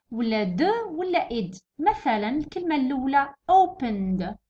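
usual speaking voice

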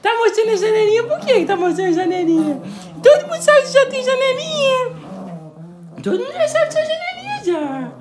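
loud high playful scolding of the dog; loud teasing. Real, not acted. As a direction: tease the dog loudly about its tooth gap. Teasing dog showing tooth gap.

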